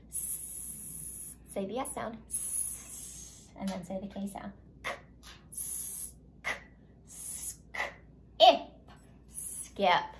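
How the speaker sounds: hissed "S", "K", "ip", then "skip"; sounding out skip. Shown as a demonstration. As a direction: lead the class through "skip" sound by sound. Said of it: "So let's say this sound".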